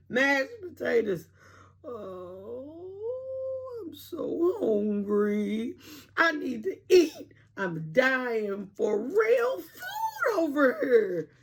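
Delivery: drawn-out pleading whine, cracking with hunger; whiny hungry moan. A narrator's reading. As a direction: moan it like you're starving. Jim whining from hunger.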